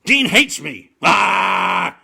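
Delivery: rising loud mock wail; hammed-up heartbroken Jerry; mock despairing cry. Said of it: "the second time was like".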